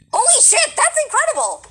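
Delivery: loud, very high-pitched excited yell; amazed outburst. Real, not acted. Interviewer hearing he went to Yale, amazed shout. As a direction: shout it in real astonishment.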